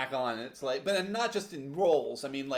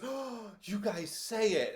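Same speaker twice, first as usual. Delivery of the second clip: higher, slow gasp of discovery; delighted discovery; 4 semitones higher, slower than usual. Retold or imitated. Co-host retelling a visitor hearing local speech, imitated delighted surprise. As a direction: marvel at it in a drawn-out voice.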